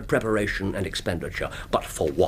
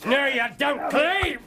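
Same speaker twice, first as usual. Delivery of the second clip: sharp shout. Acted. Holmes seizing Clay.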